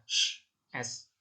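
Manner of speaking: a hushed, voiceless "sh" before the letter name "S"; sh sound. Shown as a demonstration. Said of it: "Ini cara bacanya".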